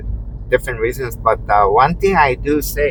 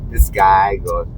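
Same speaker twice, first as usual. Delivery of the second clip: ordinary talk, nothing marked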